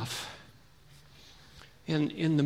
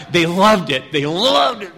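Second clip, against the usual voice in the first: emphatic delight; 10 dB louder than usual. Real, not acted. Enthusing about kids' reaction.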